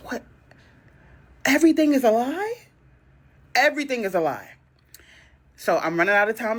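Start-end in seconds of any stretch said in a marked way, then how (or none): 0.1-0.2 stunned whisper. Retold or imitated. 1.4-2.5 incredulous question. Real, not acted. Disbelief, repeated.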